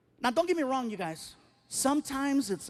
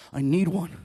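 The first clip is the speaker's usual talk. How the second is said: low, loud short reply; teenage son; 3 semitones lower, 7 dB louder than usual. Retold or imitated. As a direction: imitate a teen stating his whole case. Teenage son answers father.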